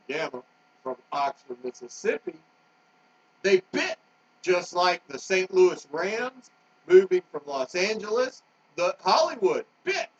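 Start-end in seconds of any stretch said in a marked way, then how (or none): 3.4-10.1 louder, higher heated rant; really getting worked up, pushing the words out hard. Real, not acted. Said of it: "I am just getting worked up".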